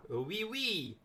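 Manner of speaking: lightly put-on French "oui, oui"; a touch of mock French accent. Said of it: "That's my French accent".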